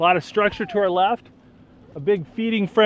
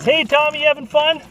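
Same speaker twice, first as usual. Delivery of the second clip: high, loud call across the boat; 10 semitones higher, 7 dB louder than usual. Real, not acted. Calling out to child on boat.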